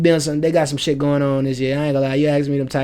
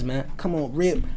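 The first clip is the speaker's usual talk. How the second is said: normal talking voice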